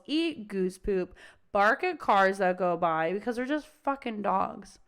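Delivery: usual speaking voice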